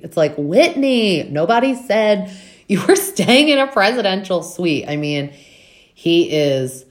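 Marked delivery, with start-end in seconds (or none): 0.4-4.8 higher, louder mock scolding with a laugh; exasperated retort. Castmate snapping back at Whitney over his suite, louder and laughing. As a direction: tell him off, half laughing.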